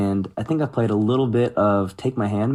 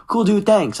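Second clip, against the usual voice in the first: nonchalant brush-off; 10 semitones higher than usual. Retold or imitated. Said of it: "they're, like, super nonchalant".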